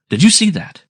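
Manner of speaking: high, loud shout across the deck; shouted question. A narrator's reading. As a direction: shout it urgently, pitch jumping up. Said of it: "I shouted".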